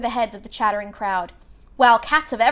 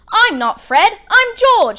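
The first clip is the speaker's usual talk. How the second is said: cheeky twin; 10 semitones higher, 9 dB louder, slower than usual. A narrator's reading. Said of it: "said the boy".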